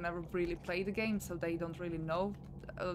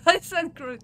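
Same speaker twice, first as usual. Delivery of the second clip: laughing through the words, higher and louder; amused outburst, pitched up and loud; 8 semitones higher, 11 dB louder than usual. Real, not acted. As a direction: burst out laughing at your own slip. Laughs at own slip.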